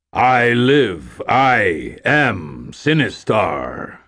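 slow, booming game voice; arcade villain voice. Acted. Arcade game taunts the player, slow and booming. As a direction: intone it like a menacing machine. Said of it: "the voice on the Sinistar game".

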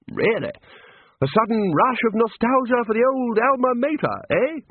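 a knowing tease, lightly voiced; teasing Arch-Chancellor. A narrator's reading.

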